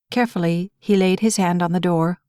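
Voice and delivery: plain reading voice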